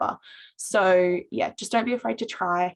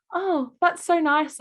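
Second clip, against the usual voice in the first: much higher, slower touched thanks; touched reply; 10 semitones higher, 4 dB louder, slower than usual. Retold or imitated. Student thanking a girl for her praise, higher, slower touched reply. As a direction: retell your flattered, drawn-out thanks. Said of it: "I'm going".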